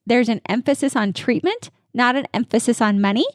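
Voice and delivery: ordinary delivery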